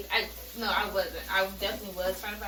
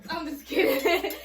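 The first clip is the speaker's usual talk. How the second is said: higher, louder, laughing through the words; real laughing aside; 7 semitones higher, 5 dB louder than usual. Real, not acted. Joking about God telling her shut up, laughing. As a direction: crack up while taking back the joke.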